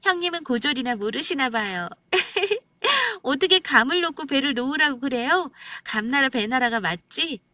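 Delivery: high, scornful sister-in-law; acted haughty insistence; defiant put-down. A narrator's reading. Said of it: "눈을 부릅뜨고 이러는 겁니다".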